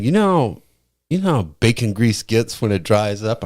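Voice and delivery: mock the coworker explaining it eagerly; imitating Vel. Quoting Vel describing sea moss, high mock voice. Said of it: "He's like".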